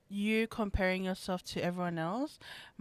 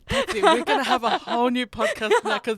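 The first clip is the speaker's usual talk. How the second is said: laughing excitedly; 4 semitones higher, 9 dB louder than usual. Real, not acted. Excited laughing reaction to Sidemen.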